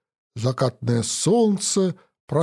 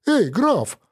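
calling out; 7 semitones higher than usual. Acted. Calling out to count.

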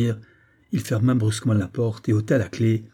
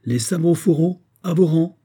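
loud, high barked order; an officer shouting at mutinous soldiers; shouted command; 8 semitones higher, 4 dB louder than usual. A narrator's reading. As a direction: shout the soldiers back into line. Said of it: "leur cria".

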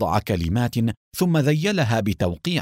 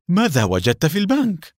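higher, eager question in a different voice; 7 semitones higher, 3 dB louder than usual. A narrator's reading. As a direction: ask it hungry for the answer. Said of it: "سأله حسين بلهفة".

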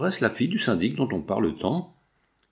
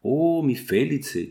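slow, drawn-out, higher exclamation; savouring it, pitched up and slow; exclaimed delight; 4 semitones higher, slower than usual. A narrator's reading. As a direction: draw out a happy cry. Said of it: "et s'exclamant".

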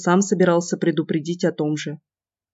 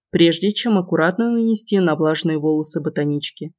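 plain reading voice

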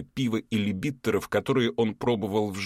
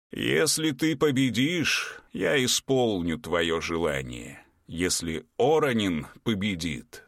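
slow, solemn giant voice; slow ancient giant; 3 semitones higher than usual. Acted. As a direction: speak like an old field spirit. Giant Pekko speaks.

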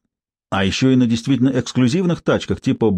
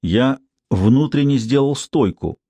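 ordinary delivery, nothing marked

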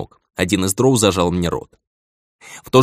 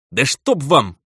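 annoyed swearing, pitched up; cursing; 6 semitones higher, slower than usual. Acted. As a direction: bite out the curse. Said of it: "Выругался я".

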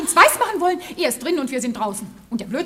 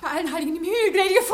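higher, louder wailing; alarmed cry; 6 semitones higher, 4 dB louder, faster than usual. Acted. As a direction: wail in fake horror. Maid fakes alarm.

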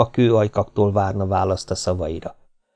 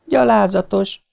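high gasped insult; breathless outrage; 11 semitones higher than usual. Acted. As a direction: spit it out out of breath. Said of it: "lihegte a lány". (zihálva, felháborodva)

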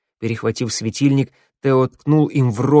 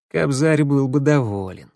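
plain reading voice

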